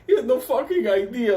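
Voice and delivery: high, loud laughing squeal; crying with laughter while telling it. Real, not acted. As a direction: lose it to laughter mid-sentence. Laughing at his friend's antics.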